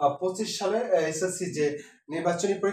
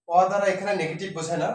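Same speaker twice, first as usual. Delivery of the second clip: normal talking voice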